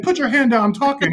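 high, loud mock reprimand; retold stern lecturing. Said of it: "I'm just keep on lecturing him".

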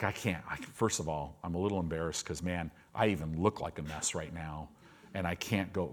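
low, soft and defeated; sick believer explaining why he stays home, low and defeated; dejected. Retold or imitated. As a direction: mumble it down, ashamed. Voicing embarrassed sick believer.

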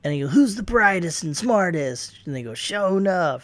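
lightly voiced gang chant; call and response. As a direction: chant it like a posse hyping its boss. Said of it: "whenever he says ... they all say".

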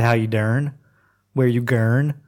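"dern" and "gurn" with er for doing and going; New Orleans er vowel in "dern", "gurn"; New Orleans pronunciation. Shown as a demonstration. Showing how New Orleans talks, "dern" and "gurn" with er. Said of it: "They say".

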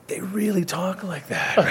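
slow, high-pitched mock astonishment; imitated shock. Friend retells a man's shock at a thick accent, imitated amazement. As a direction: play him stunned by what he hears, slow and high. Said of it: "he was so in shock".

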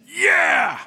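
high, loud hyped-up cheering; enthusiastic shout. Retold or imitated. Stranger in line cheering the pastor for apologizing, loud and high. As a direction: pump him up like a fan in the stands.

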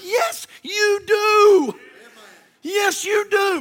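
high, drawn-out cry of insistence; emphatic rebuttal. Acted. Pastor answers someone who says they don't need church, emphatic rebuttal. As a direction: insist slowly, pitched well up.

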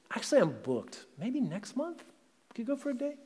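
dismissive partner. Retold or imitated. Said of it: "and they say".

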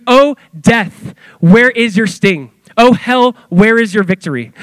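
Paul's solemn challenge to death; solemn scripture quote. Retold or imitated. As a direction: declaim it like scripture read aloud. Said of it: "He goes".

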